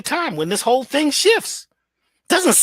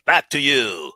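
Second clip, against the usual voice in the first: much lower, rounded newsreader tone; deep anchor voice; 8 semitones lower, slower than usual. Shown as a demonstration. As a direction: drop the pitch into a serious broadcast voice. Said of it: "non-regional voice".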